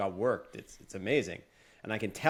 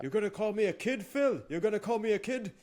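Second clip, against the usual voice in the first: high, mocking Austrian accent; Gunther Austrian accent; 10 semitones higher than usual. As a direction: sneer it in a thick Austrian accent. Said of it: "Is that your best Austrian accent?".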